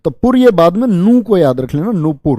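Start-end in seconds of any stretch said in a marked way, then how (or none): none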